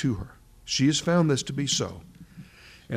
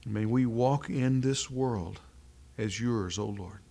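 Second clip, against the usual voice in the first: prayer; 2 semitones lower, 4 dB quieter than usual. Acted. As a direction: pray humbly for the small gathering. Closing prayer after "Let's pray.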